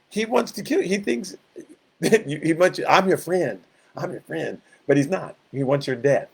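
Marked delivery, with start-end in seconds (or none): 2.9-3.6 a light hint of a sly friendly devil; devil posing as friend. 3.9-4.6 much softer, a sly near-murmur; soft devil murmur. Acted.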